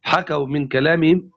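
ordinary talk

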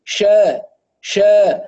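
شا from mid-tongue, strong and spread; correct strong sheen. Shown as a demonstration. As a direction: give شا its full spread hiss. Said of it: "ومخرجها قوي". (شين فصيحة قوية)

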